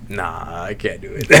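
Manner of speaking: imitating a bashful guy dropping his voice; mock embarrassed. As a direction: back out sheepishly, half under a laugh. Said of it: "He got all embarrassed and shit".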